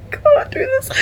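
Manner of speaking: high, breaking cry; overcome. Real, not acted. Overwhelmed by the quote she just read, voice breaking. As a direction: voice cracking with feeling.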